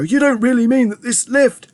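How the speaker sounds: high-pitched disbelieving protest; alarmed shout. A narrator's reading. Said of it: "shouted Grandpa Joe".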